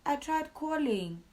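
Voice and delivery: high, loud, slow plea; pleading younger self. Retold or imitated. Her younger self pleading with her father, high and loud. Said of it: "I'm like".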